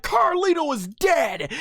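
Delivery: high, slow mock scream of a commentator; imitated commentator scream. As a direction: cry it out like a stunned ringside commentator. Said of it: "Michael Cole was screaming".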